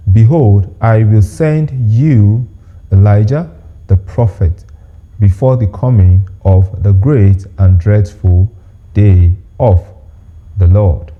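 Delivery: slow, measured Bible reading with pauses between phrases; scripture reading. Acted. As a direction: read the verse out slowly and deliberately. Reads a Bible verse aloud.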